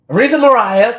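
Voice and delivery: high, loud, laughing announcement of names; excited sing-song. Pretend. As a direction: belt the names out like a show host. Playful announcement of Divas names.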